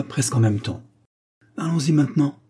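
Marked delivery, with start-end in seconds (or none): 1.6-2.4 lively, higher-pitched urging; vivement. A narrator's reading. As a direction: propose it eagerly, voice lifted. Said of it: "dit-il vivement". (vivement, voix plus haute)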